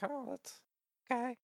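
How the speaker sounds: softly imitated mom, high and small; mom's meek reply. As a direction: let it trail off high and quiet. Voicing mom's underwhelmed reply.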